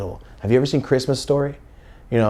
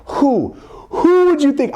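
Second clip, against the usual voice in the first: much higher, louder rhetorical question; excited buildup; 4 dB louder, slower than usual. Real, not acted. Telling viewers who the linebacker was, much higher excited question. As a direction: build up the surprise.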